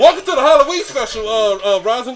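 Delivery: podcast host intro. Acted.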